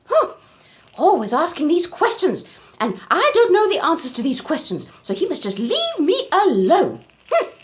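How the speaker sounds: high, loud muttering grumble; grumbling Auntie Giraffe. Acted. Auntie Giraffe grumbling to herself, high and loud. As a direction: grumble to yourself like a fussy aunt.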